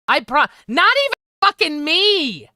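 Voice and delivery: high, loud, insistent; emphatic outburst. Real, not acted. Podcaster insists the host cares even about her, loud and emphatic. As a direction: hammer the point home.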